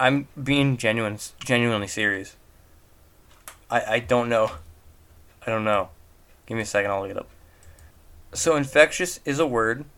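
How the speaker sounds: ordinary delivery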